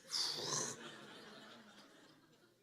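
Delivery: high laugh of relief at a near slip; breathy laugh. Real, not acted.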